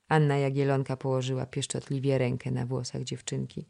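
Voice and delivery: ordinary delivery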